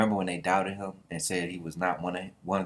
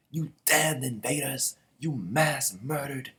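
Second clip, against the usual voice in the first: slow, raised-pitch dramatic denunciation; angry accusation; 5 semitones higher, slower than usual. Acted. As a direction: stretch out each accusing word theatrically. Acted accusation against invaders.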